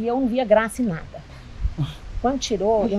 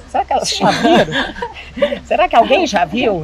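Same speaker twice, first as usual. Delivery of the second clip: louder, laughing through the question; laughing retold worry; 3 semitones higher, 7 dB louder than usual. Mother laughing as she recalls fearing everyone knew. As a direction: laugh at her own old fears. Retelling anxious thoughts while laughing.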